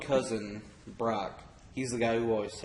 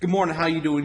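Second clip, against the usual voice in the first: higher, louder imitation of a fast talker; 4 semitones higher, 8 dB louder, faster than usual. Imitating cousin greeting him at the door, fast talker. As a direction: run the greeting together, loudly. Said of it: "a really fast talker".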